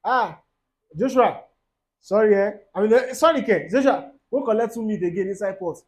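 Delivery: a grudging mother's apology, called out loud; mock Nigerian mum saying sorry; imitating a mother's sorry. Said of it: "The way your mother will say sorry".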